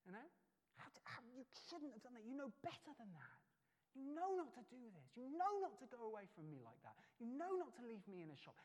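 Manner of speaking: put-on high, stern telling-off of a child; mock scolding father; dad telling off his daughter after finding her. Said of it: "I said".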